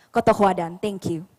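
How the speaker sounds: ordinary talk, nothing marked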